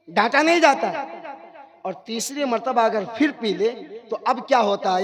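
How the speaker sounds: ordinary delivery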